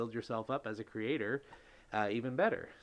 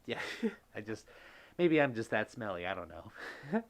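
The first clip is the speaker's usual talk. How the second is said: ordinary talk, nothing marked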